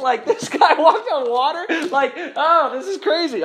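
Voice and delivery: loud, high, laughing disbelief; hammed-up amazement, words stretched out; astonished outburst. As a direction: react as if it's utterly crazy. Acted astonishment against indifferent reading.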